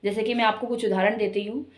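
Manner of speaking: ordinary talk, nothing marked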